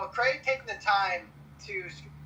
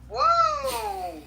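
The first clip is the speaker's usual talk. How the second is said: surprised whoa; 13 semitones higher, 4 dB louder than usual. Real, not acted. Reacting to a threat in chat.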